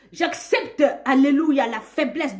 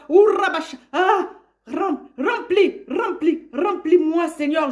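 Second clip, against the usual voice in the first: ecstatic prayer; 7 semitones higher, 6 dB louder, slower than usual. Real, not acted.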